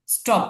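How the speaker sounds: স্টপ with ট, no puff of breath; unaspirated T. Shown as a demonstration. Teacher corrects the reading for students, স্টপ with ট sound. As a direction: say স্টপ with a plain retroflex T. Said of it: "ট এর উচ্চারণ".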